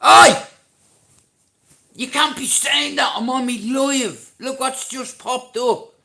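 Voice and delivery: high, full-volume yell of protest; angry shout. Real, not acted. Streamer telling off a chatter on his live, angry shout. Yells at someone in live chat.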